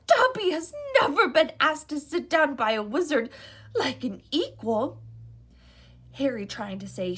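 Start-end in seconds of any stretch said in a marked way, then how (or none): 0.1-5.0 eager house elf. A narrator's reading. House elf meeting Harry, high and eager. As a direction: gush like an awed little elf.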